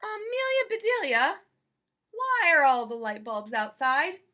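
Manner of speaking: high, loud call across the house. Acted. Mister Rogers calling to Amelia Bedelia about the bulbs, loud and high.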